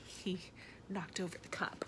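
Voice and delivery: soft whispered explanation; hushed aside. A narrator's reading. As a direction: whisper it like sharing a secret with a child. Reader's hushed aside over the picture.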